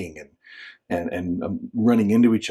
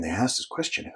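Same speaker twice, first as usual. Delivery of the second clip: usual speaking voice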